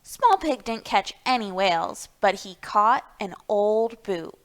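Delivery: plain reading voice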